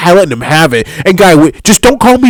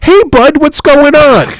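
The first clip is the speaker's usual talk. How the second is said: mock waiter greeting; 8 semitones higher, slower than usual. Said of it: "this guy was to come up to me and go".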